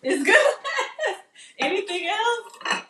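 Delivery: laughing disbelief; amused surprise. Real, not acted. Reacting to son's verdict.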